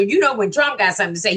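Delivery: ordinary delivery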